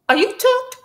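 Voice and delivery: a stunned, high-pitched question; shocked question. Retold or imitated. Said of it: "he'd be like".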